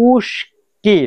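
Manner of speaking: উস্কি drawn out in two parts, "uush... ki"; pronunciation demo. Shown as a demonstration. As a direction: show learners উস্কি slowly, syllable by syllable. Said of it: "এখানে দেখেন". (উস্কি ভেঙে ভেঙে টেনে উচ্চারণ)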